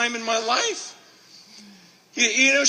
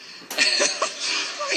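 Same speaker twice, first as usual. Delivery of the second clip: breathy laugh. Real, not acted. Laughs at brother's remark.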